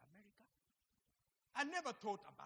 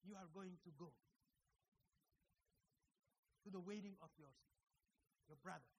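hushed voice of God; 5 semitones lower, 12 dB quieter, faster than usual. Retold or imitated. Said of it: "the same voice said".

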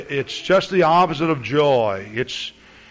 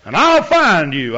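imitated threat, louder and higher; raised-voice warning; irate caller; 6 semitones higher, 7 dB louder than usual. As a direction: threaten like an angry man on the phone. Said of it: "He got so irate".

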